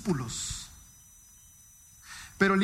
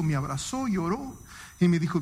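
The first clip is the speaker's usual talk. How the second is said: normal talking voice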